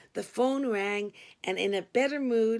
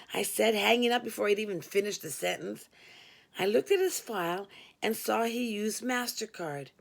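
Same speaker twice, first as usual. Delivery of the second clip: usual speaking voice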